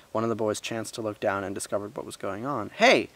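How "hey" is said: high, loud indignant call; sharp storybook shout, raised and loud. A narrator's reading. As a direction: shout down an order to stop. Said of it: "he shouted".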